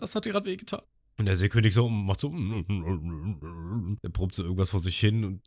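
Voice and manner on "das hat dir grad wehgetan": high, mock wincing oh; imitated pained reaction. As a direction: act out a sudden sting. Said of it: "dann ist so". (hohes, gespieltes Autsch)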